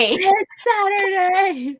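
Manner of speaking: mock-dramatic echo. Real, not acted. Friend echoing the Saturday punchline, slow dramatic exclamation. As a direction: stretch it out like a grand revelation.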